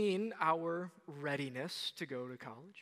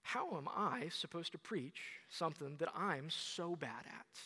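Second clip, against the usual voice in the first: much softer, muttered doubt; self-doubting aside; 7 dB quieter than usual. Retold or imitated. Preacher reading Matthew eighteen, a soft baffled aside. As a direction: mutter it to yourself in dismay. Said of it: "and I say".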